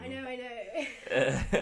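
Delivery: loud, drawn-out laughing admission; laughing agreement. Real, not acted. Agreeing with a friend about a mixed background, laughing.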